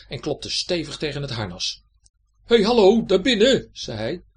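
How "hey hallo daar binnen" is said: high, loud shout into something closed; calling out. A narrator's reading. Gerrit knocks on the armour, high loud call. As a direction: holler to whoever is inside.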